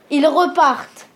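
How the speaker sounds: ordinary talk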